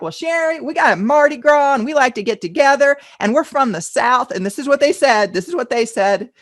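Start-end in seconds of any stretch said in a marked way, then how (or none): 0.0-4.3 loud, high, put-on Southern accent; hammed-up Southern US accent; generic Southern accent. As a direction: imitate a Southern client, high and emphatic. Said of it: "only do a generic Southern one".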